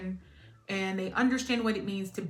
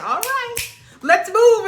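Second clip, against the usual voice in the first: impatient push; 12 semitones higher, 11 dB louder, slower than usual. Retold or imitated. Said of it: "one is like".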